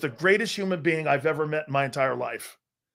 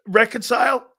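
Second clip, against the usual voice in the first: puzzled echo; 6 semitones higher, 5 dB louder than usual. Real, not acted. Confused echo of a question.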